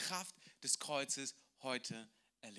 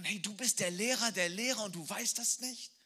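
higher, playful mock surprise; teasing disbelief; 5 semitones higher, 4 dB louder than usual. Jesus teases Nikodemus, the teacher of teachers, amused. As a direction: tease him gently.

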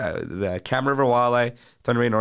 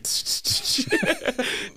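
high giggle. Real, not acted. Big laugh at a joke.

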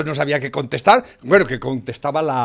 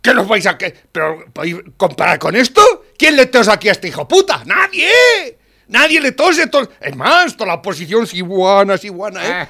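loud, much higher ranting; heated rant; 8 semitones higher, 9 dB louder than usual. Real, not acted. Man ranting to his friend about Trump, loud and heated. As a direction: shout it out in fired-up admiration.

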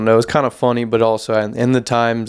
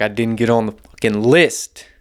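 ordinary delivery

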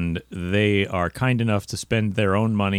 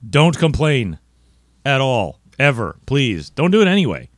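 emphatic plea; 5 semitones higher, 5 dB louder than usual. Real, not acted. Pleading with listeners not to complain.

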